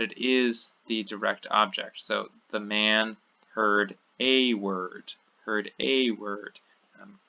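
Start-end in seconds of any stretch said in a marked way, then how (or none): 2.5-5.1 slow, with "a" said as a full "ay"; single out "a" as a long, separate "ay"; stress on A. Shown as a demonstration. Teacher warning students off a definite article, stressed "a". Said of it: "get a little happy with our English definite articles". 5.4-6.6 slow repeat, "a" stretched to "ay"; stress on A. Shown as a demonstration. Teacher repeating the indefinite translation for students, stressed "a". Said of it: "get a little happy with our English definite articles".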